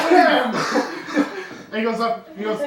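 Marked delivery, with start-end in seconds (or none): 0.1-1.4 high, loud laughing damn; loud impressed damn. Acted. Dock worker sees VIP order, says a loud laughing damn. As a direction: blurt it out, impressed and amused.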